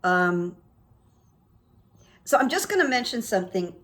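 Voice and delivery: ordinary delivery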